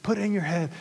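normal talking voice

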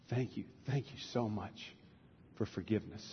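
soft, low mock-humble thanks; hushed gratitude with small pauses; humbly grateful parrot. Parrot thanks the woman for forgiveness, meek and quiet.

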